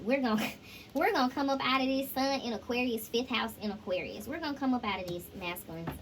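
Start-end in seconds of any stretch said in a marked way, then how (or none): none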